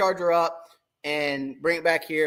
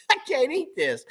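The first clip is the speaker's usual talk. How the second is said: laughing complaint; 12 semitones higher than usual. Retold or imitated.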